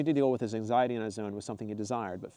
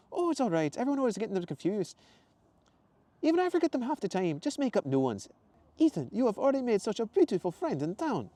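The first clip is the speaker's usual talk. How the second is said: much higher, louder quirky woman; Zoe's voice; 12 semitones higher, 4 dB louder than usual. Acted. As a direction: voice the bubbly witch shopkeeper high. Zoe voiced high.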